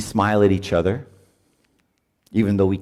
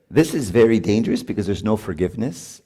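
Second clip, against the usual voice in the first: usual speaking voice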